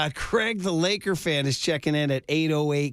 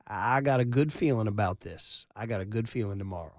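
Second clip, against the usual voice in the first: Texas drawl; 6 semitones lower, 5 dB quieter than usual. Retold or imitated. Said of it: "wagged his finger in his Texas drawl".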